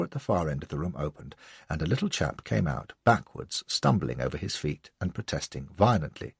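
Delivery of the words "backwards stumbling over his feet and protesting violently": plain reading voice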